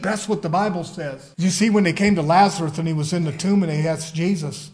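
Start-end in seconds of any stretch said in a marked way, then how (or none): none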